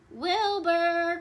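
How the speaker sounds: loud, high, drawn-out name call. A narrator's reading. Elmer calls his hidden cousin, loud searching call. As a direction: holler for someone out of sight. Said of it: "called Elmer".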